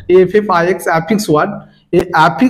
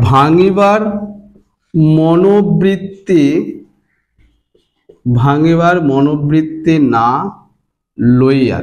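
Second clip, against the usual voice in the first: slow, word by word with pauses; slow dictation; 2 semitones lower, much slower than usual. Shown as a demonstration. Teacher giving students the meaning, slow dictation. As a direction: dictate the phrase unhurriedly for writing.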